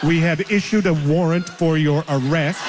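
flat robotic scam call voice. Retold or imitated. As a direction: robocall voice, laughing through it. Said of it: "robot voice saying".

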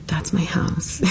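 half-whispered, hushed aside; hushed quote. Retold or imitated. Passing her own apartment in the paddy wagon, hushed. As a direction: whisper it as a private reaction. Quoting her stunned reaction from inside.

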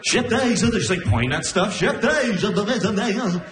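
high, garbled, looping babble; backwards-record gibberish. Retold or imitated. Girlfriend babbling in her sleep, like a record backwards. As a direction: sound like a tape played backwards. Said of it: "sounds like turning a record backwards".

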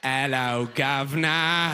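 slow, drawn-out hammed-up British greeting; mock British accent. Gawker mimics a British person, slow mock accent. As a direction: parrot a stretched cockney-style British accent. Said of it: "when you meet somebody British".

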